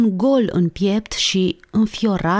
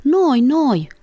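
high eager clamour; volunteer like a gateful of kids; high-pitched, insistent childlike cry; children protesting; 8 semitones higher, 3 dB louder than usual. A narrator's reading. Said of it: "protestă poarta înflorită cu copii".

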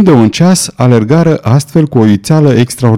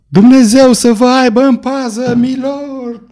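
put-on much higher child voice, fading into the distance; child's shout; 12 semitones higher than usual. A narrator's reading. Boy shouting farewell to his lord as he rides off, high child's call. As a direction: call out like a boy being carried away. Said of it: "o voce de copil striga".

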